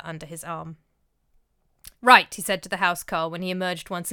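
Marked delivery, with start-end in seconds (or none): 2.0-2.3 firm decision. A narrator's reading. Olaf decides, resolute.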